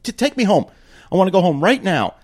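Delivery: high, firm demand; insist, fed up and angry; retold demand to go home now.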